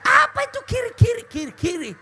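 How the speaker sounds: mocking. Real, not acted. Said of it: "mocking the song's lyric".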